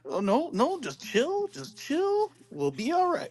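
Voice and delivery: high, soothing mock reassurance; calming voice. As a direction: talk a panicking fan down, sky high. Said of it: "You keep us in that, like".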